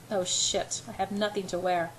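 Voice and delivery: usual speaking voice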